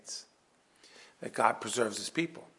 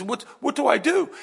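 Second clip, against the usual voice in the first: much higher and louder, frantic; panicked king; 13 semitones higher, 9 dB louder than usual. Acted. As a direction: blurt it out like a cornered man. Saul panicking, acted by preacher.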